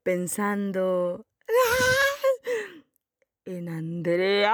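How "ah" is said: a sudden high squeal of excitement; high fan squeal. Acted.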